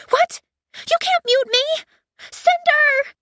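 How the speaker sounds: very high loud protesting android; high squealing alarm; panicked ship AI. Acted. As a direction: shriek it in outrage. Aiko panics.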